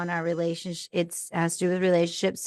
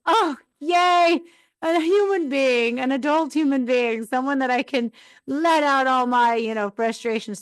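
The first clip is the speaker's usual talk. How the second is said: higher-pitched mock-gushing relief; relieved excitement; 4 semitones higher than usual. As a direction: greet him like a long-awaited rescuer. Acts out greeting husband on arrival.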